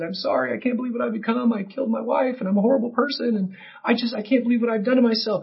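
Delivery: hint of a sorry Anakin; imagined repentant Anakin. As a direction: voice a remorseful villain, lightly. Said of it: "you think he would have repented and said".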